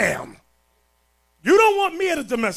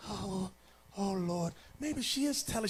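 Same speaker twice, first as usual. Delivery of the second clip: much softer, wavering mock sighs; mock swayed murmur; 4 semitones lower, 12 dB quieter than usual. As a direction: play the soft-hearted brothers caving. Gullible brothers wavering.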